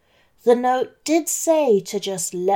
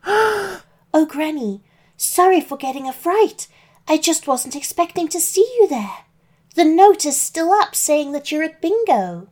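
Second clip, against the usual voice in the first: put-on higher, bright little girl voice; young girl voice; 7 semitones higher, 3 dB louder than usual. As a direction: speak as a startled little girl.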